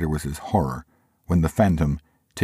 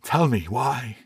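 trembling; 8 semitones higher, slower than usual. A narrator's reading.

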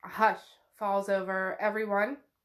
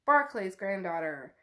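ordinary delivery

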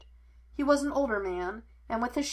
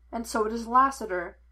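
ordinary delivery

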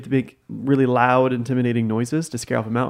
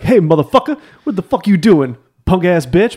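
loud, raised-pitch tough New Yorker; mock New York street threat; intimidating New York accent; 6 semitones higher, 7 dB louder than usual. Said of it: "what a New Yorker accent sounds like".

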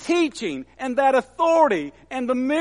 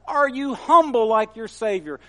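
usual speaking voice

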